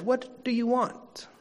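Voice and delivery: WANT lifted high on a slow, soft "What do you want"; questioning inflection. Shown as a demonstration. Preacher trying an inflection on Jesus' first words, softly questioning. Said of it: "put an inflection on it".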